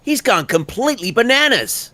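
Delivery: high, exclaiming read; high take. Shown as a demonstration. Actor offers line variations, high take. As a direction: toss it up high in disbelief. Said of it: "I'll give you a few of those".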